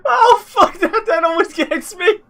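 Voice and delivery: high, laughing through the words; words squeezed out mid-laugh. Real, not acted. Still laughing after clip.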